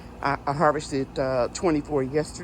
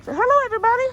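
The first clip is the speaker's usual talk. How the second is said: high sing-song prompt; 8 dB louder, much faster than usual. Real, not acted. Prompting child sweetly.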